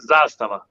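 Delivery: "Zastava" as ZAHS-tuh-vuh; demonstrated pronunciation. Shown as a demonstration. Guest moves on to teach the brand name, first-syllable stress on "Zastava". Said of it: "the pronunciation".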